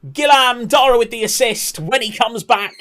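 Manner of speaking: high, loud football commentator's call; excited commentary. Acted. Streamer hyping Dara's assist to the viewers, excited commentary. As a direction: shout it out like a goal call.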